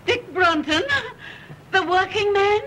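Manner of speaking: high, hysterical shock at the news; horrified disbelief. Acted. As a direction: echo it in shrieking, appalled disbelief. Mother horrified by engagement news.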